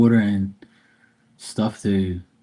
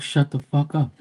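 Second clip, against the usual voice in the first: mock scolding; 6 semitones higher than usual. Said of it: "Like, tell the dog".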